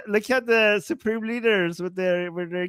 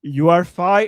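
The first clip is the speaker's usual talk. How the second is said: usual speaking voice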